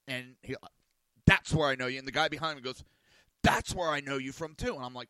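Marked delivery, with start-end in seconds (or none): 1.3-2.0 loud acted-out burst of recognition; excited recognition. Listeners recognise him, acted out. 3.4-4.7 loud, higher acted-out chime-in; excited recognition. Second listener recognises him, acted out.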